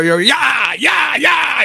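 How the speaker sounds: high, drawn-out cry to the horses; stagecoach driver's holler. Retold or imitated. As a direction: holler like you're driving a team. Imitating stagecoach drivers' yells.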